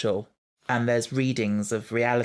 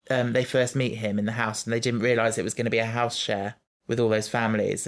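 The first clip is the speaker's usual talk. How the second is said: ordinary delivery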